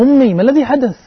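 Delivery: high, loud panicked call to his mother; alarmed cry. Retold or imitated. Son finds mother fainting. (نداء مذعور عالٍ للأم)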